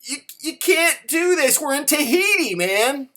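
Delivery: high, loud mock outrage; flabbergasted wife. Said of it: "your wife is just flabbergasted".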